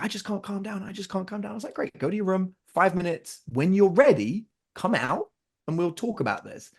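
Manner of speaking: usual speaking voice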